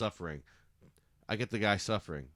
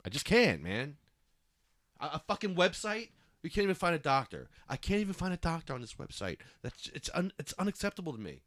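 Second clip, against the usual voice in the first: high-pitched exasperated complaint; real frustration; 7 semitones higher than usual. Real, not acted. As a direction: complain in disbelief, pitch up without shouting.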